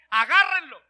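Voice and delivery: acted royal wrath; angry command. King ordering his men to seize the prophet, angry. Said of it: "con toda la ira del mundo dijo".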